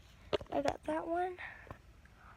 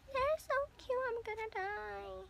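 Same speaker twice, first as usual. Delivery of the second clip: gushing; 13 semitones higher than usual. Real, not acted. Gushing over cute squishies.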